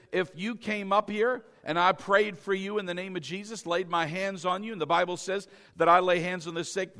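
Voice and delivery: ordinary delivery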